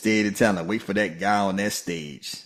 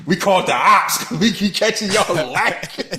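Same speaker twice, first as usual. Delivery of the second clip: laughing; 10 semitones higher, 7 dB louder than usual. Real, not acted. Laughing mimic of wrestler.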